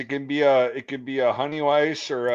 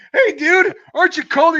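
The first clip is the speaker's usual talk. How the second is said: high, loud mock reporter voice; high and loud, reporter calling out to the shirtless dude; squeaky reporter voice; 9 dB louder than usual. Mimicking reporter asking shirtless guy.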